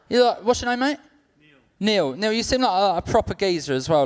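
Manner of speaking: normal talking voice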